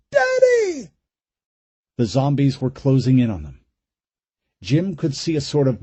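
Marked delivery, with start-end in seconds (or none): 0.1-0.9 put-on high, frightened child's cry; terrified girl crying out. A narrator's reading. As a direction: scream for her father in fear. Terrified girl calling her father.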